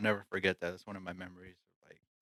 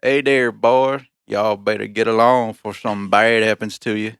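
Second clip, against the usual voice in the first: put-on cowboy accent; 6 dB louder than usual. Warning Californian newcomers, loud cowboy accent. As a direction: warn them off in a cowboy accent. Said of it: "a cowboy accent".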